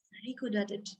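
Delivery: a hushed little aside; soft aside. A narrator's reading. Storyteller confiding to children, very soft. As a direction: drop to a near whisper, amused.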